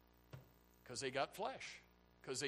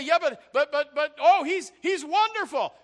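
whiny excuse; 13 semitones higher, 19 dB louder than usual. Retold or imitated. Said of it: "You say".

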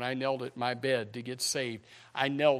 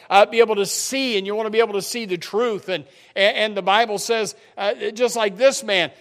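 loud, high, full-force preaching; building sermon delivery; fervent preaching; 6 semitones higher, 12 dB louder than usual. Acted.

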